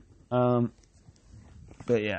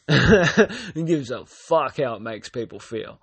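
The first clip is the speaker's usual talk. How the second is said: slow, laughing through the words; 2 semitones higher, 3 dB louder, slower than usual. Real, not acted. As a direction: chuckle while ranting.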